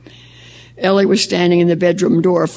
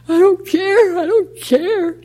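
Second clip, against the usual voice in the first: much higher, slowing, tearful denial; a boy sobbing out his defiance; crying protest; 13 semitones higher, slower than usual. Acted. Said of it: "He was crying now".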